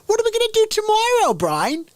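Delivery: high, fast squeaky mouse; stupid idiot mouse in a piping cartoon voice; Pinky. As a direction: falsetto question, dropping on the name. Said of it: "Pinky would turn".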